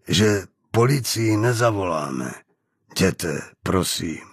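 low, slow, soothing negotiation; calm as possible. A narrator's reading. Hostage soothing an armed avenger, as calm as possible. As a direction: keep it low and measured, with careful pauses. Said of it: "hlasem tak klidným, jak v téhle vypjaté chvíli dokázal".

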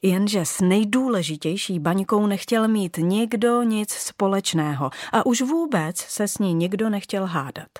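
ordinary delivery, nothing marked

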